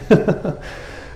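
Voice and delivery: a short high breathy laugh; a real laugh, mostly air; airy laugh. Real, not acted. Laughs while reading.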